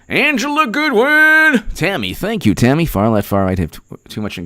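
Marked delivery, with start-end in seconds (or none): 0.1-1.6 very high, drawn-out name call; playful falsetto announcement of a name; high-pitched shout-out. Real, not acted. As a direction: squeal the name slowly. Calling out a viewer's name.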